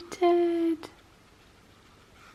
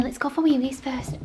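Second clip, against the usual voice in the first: ordinary delivery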